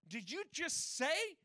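acted outraged disbelief, high and loud; high, loud, indignant mock outburst; hissy fit. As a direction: play scandalized Pharisees losing their minds. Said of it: "threw an ever-loving hissy fit. They lost their minds".

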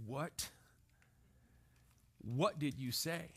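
a slow, disbelieving what, drawn out; slow stunned dad. Retold or imitated. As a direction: let it sink in, then ask. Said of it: "that slow turn".

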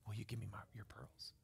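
soft unvoiced question; hushed father. Father gently whispering to his daughter at bedtime.

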